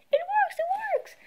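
high, loud joyful shout; excited cry. Acted. Kid cheering as his robot works. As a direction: burst out with delight. Robot comes alive, joy.

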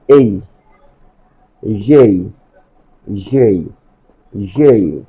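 এই as separate gliding syllables; follow-me letter drill. Shown as a demonstration. Teacher asks pupils to follow, এই clearly spaced. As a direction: model each এই, leaving space to repeat. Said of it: "follow me".

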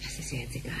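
soft, hurried whisper; whispered brush-off. Acted. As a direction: keep it down, scolding under your breath. Secretive talk at night.

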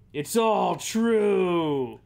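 slow, loud dramatic declaration; dramatic shout. Acted. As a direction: bellow it slowly, dramatic. Detective narration climax.

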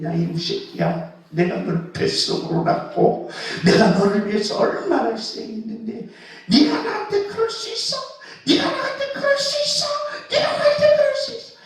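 a wounded mother's rant that builds into a shrill, high, fast outburst; mother's scolding rising to a shriek. Retold or imitated. Mother yelling at her defiant child, building to a high fast shriek.

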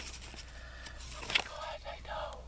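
breathy whispered aside, no voice at all. Real, not acted. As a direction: drop to a secret whisper. Whispered aside about prices.

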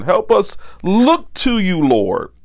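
ordinary talk

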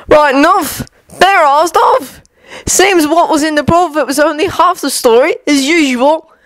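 high, knowing barman; gossiping publican; barman Tom voice. Acted.

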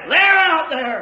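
loud, punched-out pulpit call; preaching shout. Real, not acted. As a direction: fling it out to the crowd. Preacher exhorts at full force.

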